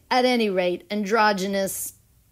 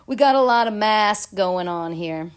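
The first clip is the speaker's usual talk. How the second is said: normal talking voice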